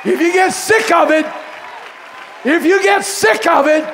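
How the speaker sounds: high, loud preacher shout; shouted preaching. Real, not acted. Preacher shouting a refrain to the congregation, full shout. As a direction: hammer the refrain at the crowd.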